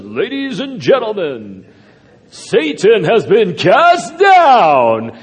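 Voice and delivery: hammed-up ballpark announcer drawing out every word; stadium announcer. Heaven's announcer tells everyone over the loudspeaker, booming and slow. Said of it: "heaven has an announcer".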